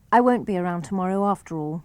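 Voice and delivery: ordinary delivery, nothing marked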